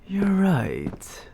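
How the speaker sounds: ordinary delivery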